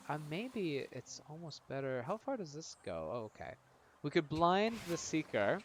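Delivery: ordinary talk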